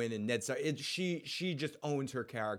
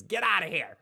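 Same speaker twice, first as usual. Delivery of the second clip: raised, louder mock yell; higher, forceful imitated scream; 6 semitones higher, 7 dB louder than usual. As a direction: yell it like a kid shooing someone off. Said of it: "she just screams".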